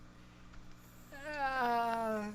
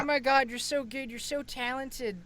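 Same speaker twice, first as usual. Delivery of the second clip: higher-pitched imitation of gushing praise; gushing admirers; 6 semitones higher than usual. Imitating people praising an artist, gushing. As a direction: gush like an impressed fan, pitched up. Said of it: "people go".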